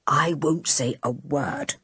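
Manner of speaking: low, soft and slow; man promising the girl to keep her secret; gentle promise. A narrator's reading. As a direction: reassure her quietly. Mister Luffy's reply.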